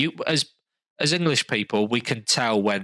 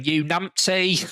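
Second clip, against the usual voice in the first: mock London slang; 6 semitones higher, slower than usual. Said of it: "people in the background going".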